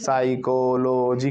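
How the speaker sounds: साइकोलॉजी dragged out as sai-ko-lo-ji; too many breaks. Shown as a demonstration. Teacher exaggerates the mistake, साइकोलॉजी slowly broken sai-ko-lo-ji. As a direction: slowly pull साइकोलॉजी apart, syllable by syllable. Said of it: "अलग-अलग breaks आ रहे हैं".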